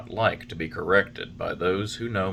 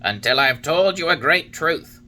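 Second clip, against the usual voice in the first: a higher, louder, wise little cricket voice; Talking Cricket; 7 semitones higher, 6 dB louder than usual. A narrator's reading. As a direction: voice the old cricket, stern and knowing. Cricket warns Pinocchio.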